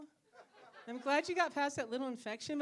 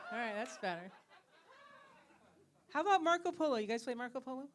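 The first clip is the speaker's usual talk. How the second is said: ordinary talk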